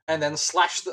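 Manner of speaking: usual speaking voice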